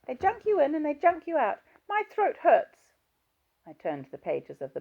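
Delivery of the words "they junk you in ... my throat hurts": put-on high, loud little-girl voice from an old woman; high childlike whine, much louder; childish voice. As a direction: play an old patient talking like a small child. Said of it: "The childish voice continued".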